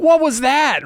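sudden high alarmed cry; startled. Retold or imitated. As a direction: jump at the strange noise. Players startled by warble.

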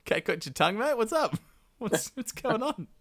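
high, teasing nudge at a silent dad; mock prodding son. Rama to his mute father, high teasing prod. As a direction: poke at him to get an answer.

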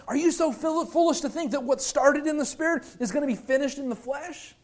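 high, incredulous rebuke; Paul beside himself. Acted. Paul asking if they are so foolish, acted exasperation. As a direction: question them in exasperated disbelief. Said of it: "Paul is beside himself".

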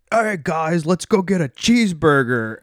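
cartoony American accent. British wrestlers mimicking Americans, cartoony American accent. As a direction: imitate Brits doing a cartoonish American. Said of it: "like, stereotypical American accent".

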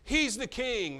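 high, loud scornful jeer; a preacher acting out the mockers' taunt; mocking. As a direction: throw it out as a taunt. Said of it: "they say".

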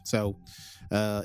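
ordinary talk

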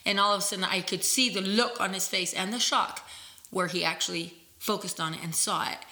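ordinary delivery

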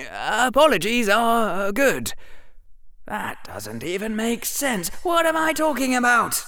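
high, rambling flustered Harry; high panicked babble; babbling boy. A narrator's reading. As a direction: talk yourself into a corner.

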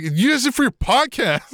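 high-pitched whiny outrage, voicing the radio guy; angry complaint. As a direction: squeal it like an upset DJ.

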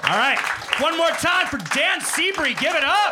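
high, loud stage intro; hype announcer. Real, not acted. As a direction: whip the crowd up for the comic. Host hypes crowd for comedian.

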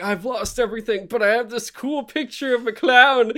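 high, loud mock-cheerful victim voice; ruined NFT buyer. Hospital patient bragging about his clown NFT, high hammed-up glee. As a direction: play a wrecked man proud of his clown picture. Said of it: "It's like".

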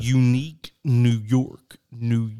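slow, loud tongue twister; carefully spelling out the twister. Shown as a demonstration. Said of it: "Hold your tongue and do it".

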